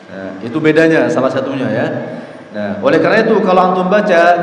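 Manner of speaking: usual speaking voice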